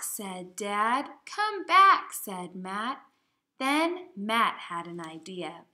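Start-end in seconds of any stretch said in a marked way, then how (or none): 1.2-2.1 high, loud "Come back" call. Acted. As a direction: call after the parrot flying off. Matt calls the parrot.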